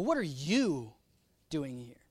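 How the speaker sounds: slow, swooping YOU, then pause; pointed YOU, low tail; stress on YOU. Shown as a demonstration. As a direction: lean on YOU and drop the ending. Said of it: "what if God said it this way".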